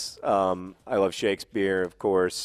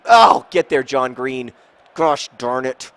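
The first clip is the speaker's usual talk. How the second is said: loud, raised burst of frustration; getting angry; 3 semitones higher, 10 dB louder, slower than usual. Real, not acted. Gamer scolding his player mid-lesson, getting angry. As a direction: yell at the player, then curse mildly. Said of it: "I'm kind of getting angry".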